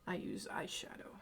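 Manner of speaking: soft whisper. Real, not acted. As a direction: whisper it to the camera. Quiet aside while applying makeup.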